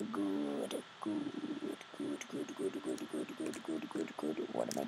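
very low, soft monster mutter; deep creepy voice. Acted. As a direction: drop way down into a goblin mutter. Muttering while sneaking.

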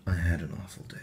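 breathy unvoiced whisper; whispered sad confession. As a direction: hush it like a secret. Whispered lines.

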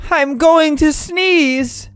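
put-on baby voice. Baby voice, slow.

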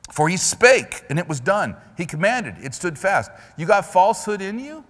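high, loud fiery preaching. Acted. Preacher rousing the congregation with loud high fire. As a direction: preach it out to the whole church. Preaching builds in full force.

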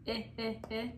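quiet, separate "eh, eh, eh"; short vowel sound. Shown as a demonstration. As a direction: repeat the short vowel eh in little bursts. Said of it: "short vowel".

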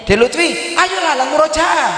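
high, pushy urging; nagging parent. Shown as a demonstration. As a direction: push the child to recite. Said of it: "Biasanya pada umumnya".

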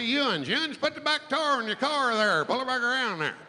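North Georgia twang, heavy on the R; North Georgia accent. Acted. Local directing a driver about a tire, North Georgia accent. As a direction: put a hard R into North Georgia speech. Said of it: "They don't say y'all, they say".

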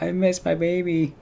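mock crying. Missing her cat at school, hammed-up teary whimper. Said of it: "at school crying".

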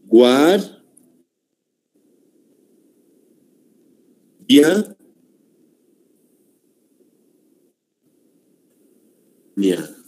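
"Guar-, dia-, nia" with very long pauses between; split into syllables. Shown as a demonstration. Teacher working through the answer, "Guar-, dia-, nia" syllable by syllable. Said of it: "vamos a separar".

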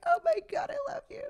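high-pitched gushing quote; mock squeal of adoration; gushing affection. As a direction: gush it high, like melting with love. Acted gushing quote to her brother.

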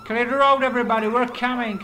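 yelling at traffic to get out of the way; shouting. Real, not acted. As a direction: yell it out the window mid-chase. Calling out during police chase.

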